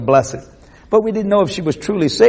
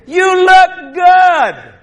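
slow, high, loud holler; booming call; 13 semitones higher, 9 dB louder, much slower than usual. Retold or imitated. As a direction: draw the words out long and loud. Stranger calls it out again.

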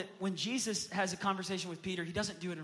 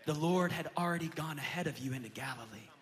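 usual speaking voice

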